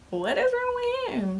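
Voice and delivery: squeaky high, slow incredulous question; baffled disbelief. Retold or imitated. Recalling her brother's snub, squeaky high disbelief. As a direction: shoot way up in pitch in mock shock. Confused at brother skipping birthday.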